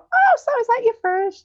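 high, slow sweet stranger voice; nosy stranger. Retold or imitated. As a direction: imitate a chirpy lady asking. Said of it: "this lady came over".

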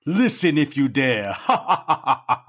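slow, eerie horror announcer; spooky host voice. Pretend. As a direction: draw it out like a creepy host. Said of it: "how good of an imitation that was".

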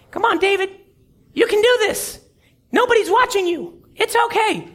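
high tempting whisper-like coax; a small devil on his shoulder coaxing David; little devil. Acted. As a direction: lure him in, sweet and sly. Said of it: "the little angel and the little devil".

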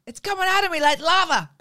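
loud, high mock-anguished cry; Bridesmaids quote wail. Quoting a film's toilet scene, loud wail. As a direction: wail it at full comic pitch. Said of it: "quote Bridesmaids".

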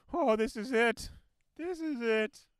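loud, high doomsday wail; hammed-up panic. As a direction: cry out as if the end has come, for laughs. Acting out panic at being sick.

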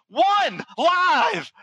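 high-pitched imitation of a studio cue; called-out countdown cue. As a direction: call the final count, acted out. Acting out the on-air countdown cue.

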